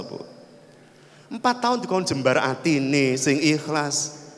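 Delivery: ordinary delivery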